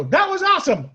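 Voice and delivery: high, loud delighted shout; thrilled. Retold or imitated. Popping up after being flipped, shouting high and loud in delight. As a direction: burst out with joy after the somersault.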